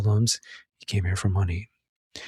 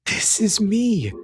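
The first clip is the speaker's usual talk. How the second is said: pleading son; 8 semitones higher, much slower than usual. Retold or imitated. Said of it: "And Nick goes".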